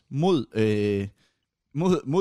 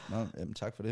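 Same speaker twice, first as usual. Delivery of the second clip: softer, offhand brush-off thanks; mock arrogant; 3 semitones lower, 7 dB quieter than usual. Streamer snubbing a fan's congratulations, arrogant. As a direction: play arrogant with a dismissive thank-you. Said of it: "sådan arrogant og var sådan".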